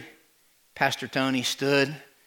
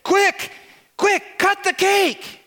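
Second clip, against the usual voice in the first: hurried Harry; slower than usual. Acted. Harry rushing her.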